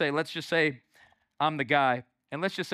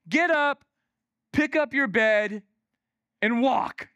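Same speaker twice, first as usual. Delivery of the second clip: loud, raised, spaced-out order; firm command; 6 semitones higher, 5 dB louder, slower than usual. Acted. Jesus commanding the man to walk, firm and loud. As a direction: order him onto his feet, phrase by phrase.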